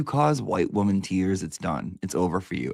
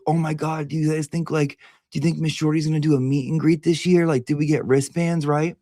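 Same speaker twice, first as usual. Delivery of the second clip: excited fan voice; 7 semitones higher than usual. Retold or imitated. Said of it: "I'd be like".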